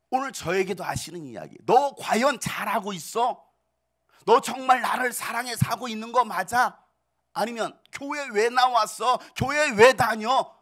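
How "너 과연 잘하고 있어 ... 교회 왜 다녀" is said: a lightly voiced stern Lord, calling out the church; Jesus rebuking. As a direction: question them like a stern Lord. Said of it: "라고 말씀하시는 거예요".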